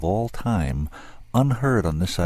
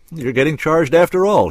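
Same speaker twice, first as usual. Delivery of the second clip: jovially; 9 semitones higher, 7 dB louder than usual. A narrator's reading. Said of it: "he said jovially".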